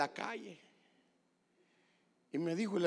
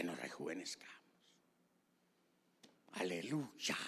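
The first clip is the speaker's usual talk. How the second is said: low, soft, reverent preacher's voice; hushed sermon aside to the congregation; hushed preaching; 10 semitones lower, 8 dB quieter than usual. Real, not acted. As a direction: preach it softly and intimately, sinking low.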